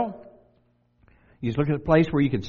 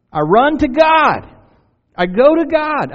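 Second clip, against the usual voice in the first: raised high and loud, ringing out; fervent proclamation; 10 semitones higher, 9 dB louder, slower than usual. Acted. Preacher voicing David to the congregation, loud fervent cry. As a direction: cry it out as David running to God.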